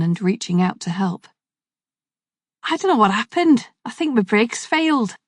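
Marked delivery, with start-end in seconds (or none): 2.6-5.2 higher, shaken Scouse voice after a crash; Scouse accent. Acted. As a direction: play the dazed Liverpudlian cyclist. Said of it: "Potbelly Man spoke in a Scouse accent".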